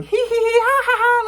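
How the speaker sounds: high mock giggle; imitated giggle. Retelling Katie's laugh through the door, high mock giggle. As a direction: mimic a carefree high-pitched laugh. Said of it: "I hear Katie laughing".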